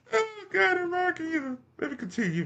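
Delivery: high-pitched laughter breaking the words; cracking up mid-sentence; wheezing laugh while trying to go on; laughing through words. Real, not acted.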